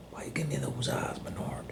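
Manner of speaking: soft whisper, almost no voice. Acted. Owner whispers at his staring dog, soft whisper. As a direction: hush it at the dog.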